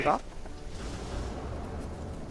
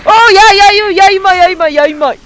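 loud, high volley of scolding; agitated shouting; 8 semitones higher, 10 dB louder than usual. Real, not acted. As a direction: yell repeatedly at the attacker, coming down in pitch. Scolding an attacker in the game.

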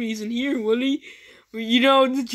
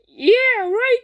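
squeaky high mock yeah, right?; 11 semitones higher, 6 dB louder than usual. Teasing the cameraman in a high squeaky voice. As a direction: pitch it way up to tease.

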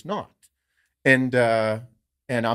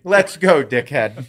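mock tough taunt; 8 dB louder, much slower than usual. Retold banter with the guy.

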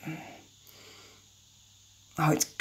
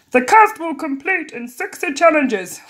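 higher, swooping announcer voice; TV show host; 9 semitones higher, 4 dB louder than usual. Acted. Host welcomes the crowd, hammed-up announcer voice. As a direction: present it grandly, hammed up. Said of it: "What was his voice?".

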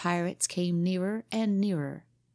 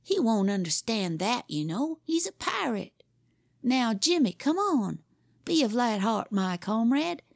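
put-on higher boyish voice, grand then impatient; boy playing pirate captain; 5 semitones higher than usual. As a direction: swagger like the Red Captain, then snap at the dawdler.

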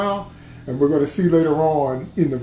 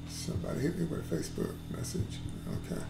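much softer, breathy side remark; whispered aside; 16 dB quieter, faster than usual. Real, not acted. Preacher aside to himself about a message, whispered. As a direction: drop out of the sermon to a murmur.

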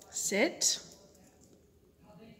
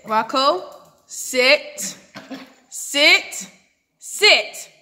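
firm command; 4 semitones higher, 10 dB louder, much slower than usual. Real, not acted. Command building.

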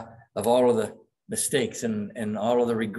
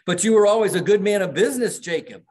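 much higher, louder and fast, protesting; 9 semitones higher, 8 dB louder, faster than usual. Retold or imitated. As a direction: blurt it out in a rush, high and loud. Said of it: "Scrooge says".

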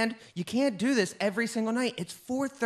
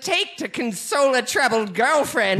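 louder, giggling villain riddle; laughing Riddler taunt; 3 semitones higher, 6 dB louder than usual. Acted. Riddler mocking Batman while laughing loudly. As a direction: gloat through the giggles.

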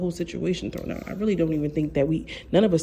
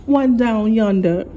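mock Australian accent; 4 semitones higher, 6 dB louder, slower than usual. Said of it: "This is my Australian voice".

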